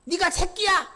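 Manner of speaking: high, loud sneering accuser; loud mocking voice of Satan scolding a family; Satan's taunt. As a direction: berate the spouse as Satan would, with scorn. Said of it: "라고 얘기합니다".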